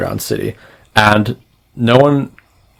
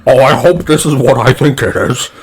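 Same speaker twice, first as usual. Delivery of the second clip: eager blob-monster voice, raised pitch; higher-pitched, hopeful creature voice; giant blob creature; 4 semitones higher, 4 dB louder than usual. Acted. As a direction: put on a bulbous alien's voice, hopeful. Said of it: "he is this giant, bulbous, blobbyish".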